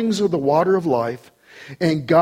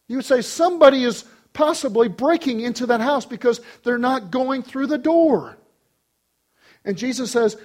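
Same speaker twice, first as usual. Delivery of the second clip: high-pitched, urgent exposition; insistent preaching; 8 semitones higher than usual. Real, not acted. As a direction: argue it from the pulpit. Preaches at full force.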